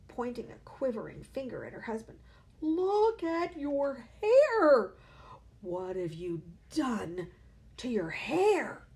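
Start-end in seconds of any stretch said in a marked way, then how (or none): 2.6-8.9 slow, loud, higher horrified cry; shrieking. A narrator's reading. Mother shrieking at her husband's dyed hair, slow and loud. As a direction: drag out hair in shock. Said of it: "the mother was shrieking".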